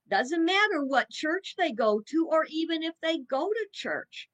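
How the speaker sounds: normal talking voice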